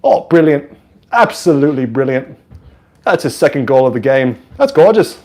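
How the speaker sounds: lightly put-on English commentator accent; understated English-accented praise for a goal; mild English announcer impression. Streamer mimics restrained English announcers, English accent. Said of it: "expression or passion. It's always just like".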